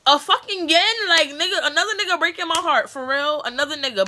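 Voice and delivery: high, loud and fed up, as if screaming inside her head; exasperated inner rant. Retold or imitated. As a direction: throw the question up in disbelief, voice climbing. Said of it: "in my head, I'm like".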